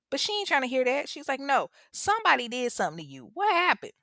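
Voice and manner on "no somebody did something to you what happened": high, quick demand rising at the end; insistent mother. Retold or imitated. Mother pressing her son for what happened, insistent. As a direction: imitate a mom who won't accept the answer.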